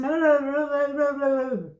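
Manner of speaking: imitating someone rattling on; a demo of hard-to-follow talk; mock gabble. Shown as a demonstration. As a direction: babble the blahs like nonstop chatter. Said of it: "you can talk like this".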